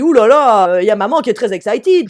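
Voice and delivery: loud mocking "ouh là là"; husband's teasing loud exclamation. Husband teasing his wife loudly about her excitement during tennis. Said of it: "Bertrand qui dit".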